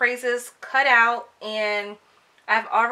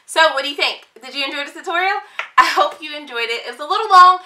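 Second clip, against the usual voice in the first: bright, fast YouTube outro energy; vlogger; 5 semitones higher, 7 dB louder, faster than usual. Acted. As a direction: pump it up like a vlogger signing off. Upbeat vlog outro to viewers.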